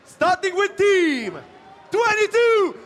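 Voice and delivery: arena announcer. Acted. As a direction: build the team number into a cheer. Announcing teams to the crowd.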